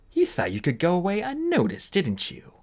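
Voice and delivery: high sneering queen voice; mocking. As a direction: sneer down at the prisoner. Queen mocks captured thief.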